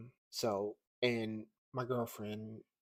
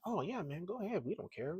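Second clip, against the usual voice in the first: higher, sing-song casual okay; easygoing shop staff; 8 semitones higher than usual. Retold or imitated. Comic shop staff letting him record, imitated as easygoing. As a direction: mimic someone breezily giving permission.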